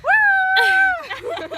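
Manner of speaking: high, loud whoop; cheering whoop. Real, not acted. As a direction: whoop in triumph. Cheering on the rock.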